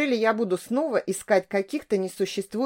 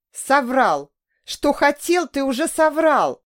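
much higher and louder, crying out; yelling through tears; 6 semitones higher, 6 dB louder, slower than usual. A narrator's reading. Woman screaming at her lying lover through tears. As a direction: scream it back at him, in tears. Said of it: "орала я сквозь слёзы".

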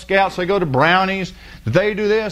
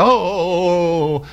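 mock excited panic. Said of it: "they'll come all excited".

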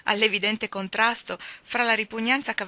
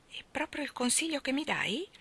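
curious, anxious; 9 semitones higher than usual. A narrator's reading. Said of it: "con un'espressione curiosa".

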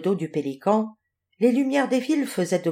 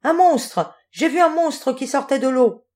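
high, louder cry of alarm; frightened cry; 6 semitones higher, 4 dB louder than usual. A narrator's reading. Jules flees the river to the pelican's back, crying out in fright. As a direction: blurt out a panicked warning. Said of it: "Il poussa un grand cri".

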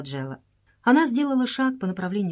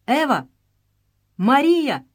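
calling out the names, louder and higher; exclaiming; 6 semitones higher, 6 dB louder, slower than usual. A narrator's reading. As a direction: cry out to the girls in despair. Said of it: "восклицая".

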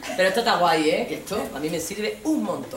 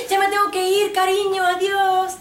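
high, loud, hurried farewell; chirpy goodbye, much higher and louder; hurried sing-song goodbye; 13 semitones higher, 6 dB louder than usual. Retold or imitated. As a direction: call it out while rushing off. Acting hurried goodbye to boyfriend.

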